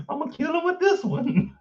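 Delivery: high, laugh-choked words. Real, not acted. Comedian cracking up at her own joke, laughing. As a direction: squeeze it out through real laughter.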